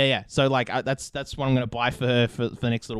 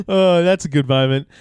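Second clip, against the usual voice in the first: laughing; 2 semitones higher, 5 dB louder than usual. Real, not acted. Laughing after Google mishap.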